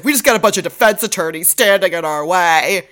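half-yell it in mock frustration. Host imitating the captain griping about defense attorneys, mock half-yell. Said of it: "Cragen kinda yells".

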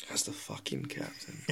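sighed out, very low and soft; deflated near-whisper. Acted. Restarting his interrupted title as captain, sighing and whispery. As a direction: let it out as a weary whisper.

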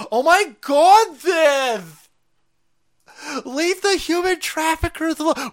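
high, loud shrieking mock outrage; mock outraged liberal. Mocking critics of the policy in a shrill outraged voice. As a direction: squeal like a horrified critic.

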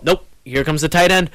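normal talking voice

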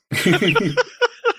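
rising burst of laughter; high laugh. Real, not acted. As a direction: break into laughter. Laughs at own joke.

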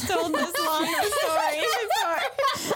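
slow teasing line through laughter; laughing tease. Real, not acted. Teasing a nervous friend, slow and laughing. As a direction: tease her while cracking up.

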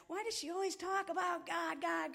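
light mock gossip voice. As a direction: mimic a nosy onlooker. Mocking what others say of her.

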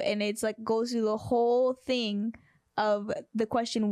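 ordinary delivery

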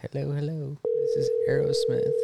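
silly phone voice. Pretend. Prank voice pretending on phone.

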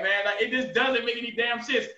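ordinary talk, nothing marked

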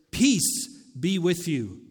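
slow, firm declaration. Acted. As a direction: state it with weight, as a proclamation. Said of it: "he is making a declaration".

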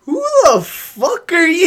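high, loud, slow angry shout; hammed-up roar at the stranger; mock furious yell. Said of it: "all you hear is".

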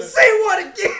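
shouting through laughter; Samuel Jackson shout. Retold or imitated. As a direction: yell it, cracking up. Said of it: "puis il est comme".

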